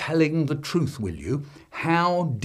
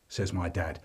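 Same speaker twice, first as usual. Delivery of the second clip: normal talking voice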